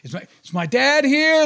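hammed-up young son calling out; kid asking for dad. Boys asking guard for dad.

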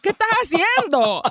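high-pitched exasperated mock scolding. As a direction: scold her in playful disbelief. Teller mock-scolds the character.

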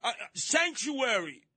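high, slow mock plea for sanctuary; repeated plea. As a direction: drag the word out, begging again.

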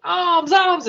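very high, giddy cheering; elated crowd cry. Acted. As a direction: sing out high in mock jubilation. Natives all rejoice in money.

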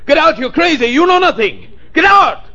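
very high, hysterical screamed order; frantic shouting. Acted. Psychic doctor ordering a client to get out, hysterical shouting. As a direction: drive them out in a panic, voice far up.

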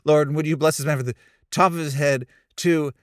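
prayer through laughter. Retold or imitated. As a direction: try to pray while laughing at yourself. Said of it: "I started".

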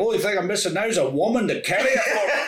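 hammed-up old Scottish papa; mock Scottish brag with a laugh; Scottish drunk. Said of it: "old drunk Scottish papa".

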